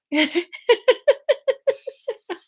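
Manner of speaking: real burst of high laughter; can't keep a straight face; giggling through the accent; high giggle. Real, not acted. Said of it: "laughing at own accent".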